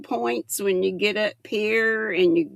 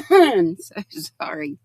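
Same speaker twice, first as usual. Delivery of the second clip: normal talking voice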